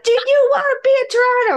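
high, loud hammed-up bellow; idiot sailor. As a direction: holler it like a goofy sailor. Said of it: "like the idiot sailor's like".

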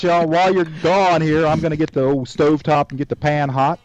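ordinary talk

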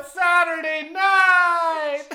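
booming TV announcer, drawn out. Pretend. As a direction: shout it like a late-night show intro. Mock TV show opening shout.

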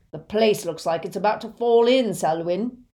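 loud, coolly observing henchman; cool second voice. A narrator's reading. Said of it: "said a cool second voice".

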